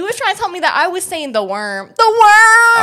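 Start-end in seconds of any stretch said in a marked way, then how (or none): 2.0-2.8 loud creepy call. Acted.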